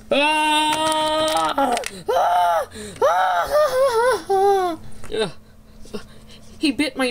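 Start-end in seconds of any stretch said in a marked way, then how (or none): 0.1-4.8 shrill terrified screaming; high screams. Acted. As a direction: scream in panic, high and loud. Monster bites nose.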